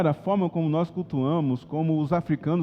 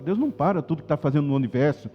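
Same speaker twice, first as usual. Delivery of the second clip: usual speaking voice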